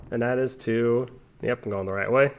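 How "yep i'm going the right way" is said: ordinary talk, nothing marked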